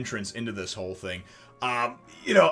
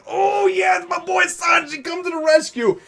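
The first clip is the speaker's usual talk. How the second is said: much higher, loud excited shout; excited cheer; 8 dB louder than usual. Retold or imitated. Fan cheering Sanji coming to the rescue, high excited shout. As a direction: cheer the hero on as he arrives.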